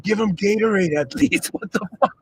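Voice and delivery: high, loud protest breaking into laughter; incredulous complaint collapsing into real laughter; laughing mock outrage. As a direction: squeal it out while cracking up. Laughing through an acted reaction.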